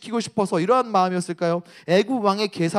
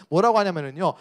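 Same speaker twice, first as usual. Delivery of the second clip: normal talking voice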